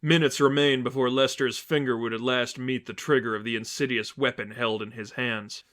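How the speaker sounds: ordinary delivery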